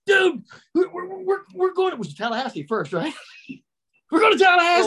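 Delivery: high, sheepish reveal; mock buddy voice. Scott confessing the kidnapping to Jimmy, high mock voice. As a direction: break the news with a nervous grin. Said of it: "Scott's like".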